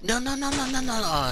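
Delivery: high, rising panic; frantic, high-pitched denial; panicked no's. Real, not acted. Dying in the game.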